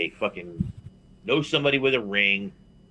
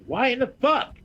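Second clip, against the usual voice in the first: incredulous outburst; 11 semitones higher, slower than usual. Retold or imitated. Retold outraged reply to wrestler.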